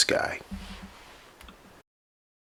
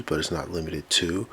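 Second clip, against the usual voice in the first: usual speaking voice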